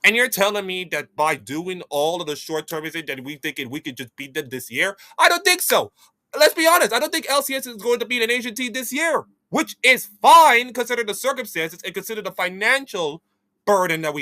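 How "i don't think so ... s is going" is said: high, loud incredulous scoff; emphatic disbelief. Real, not acted. Vlogger scoffing at LCS chances, high and loud. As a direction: scoff at the idea, pitched way up. Emphatic rebuttal, much higher and louder.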